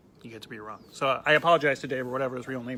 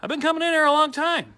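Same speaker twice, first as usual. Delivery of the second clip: high, louder accusing tone; pointed customer; 12 semitones higher, 5 dB louder than usual. Retold or imitated. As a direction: regular confronting the bartender who forgot his name. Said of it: "He goes".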